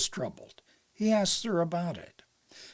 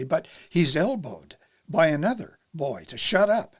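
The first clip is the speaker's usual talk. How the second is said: ordinary delivery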